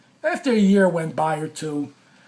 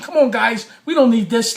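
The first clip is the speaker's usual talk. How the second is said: loud, raised-pitch imitation of peacemakers; pleading to calm down; 5 semitones higher, 6 dB louder than usual. Two interns trying to defuse the fight, pleading to calm down. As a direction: urge them to drop it, imitating the interns. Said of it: "just diffuse the whole situation".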